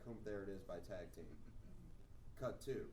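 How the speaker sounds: normal talking voice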